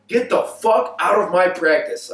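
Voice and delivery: high, slow, emphatic dismissal; retold angry order. Coach kicking player out of practice, retold angry order. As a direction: imitate a coach throwing a player out.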